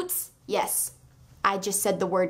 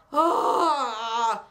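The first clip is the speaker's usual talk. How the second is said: anguished cry. Real, not acted. Anguish over plot.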